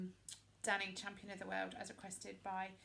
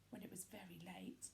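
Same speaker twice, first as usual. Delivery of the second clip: hushed, breathless whisper; 2 semitones lower, 9 dB quieter than usual. A narrator's reading. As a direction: whisper it like a secret. Sneaking into palace.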